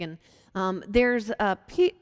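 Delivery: ordinary delivery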